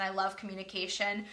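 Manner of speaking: ordinary delivery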